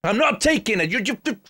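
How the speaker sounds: high-pitched, rushed indignant rant; mock outrage.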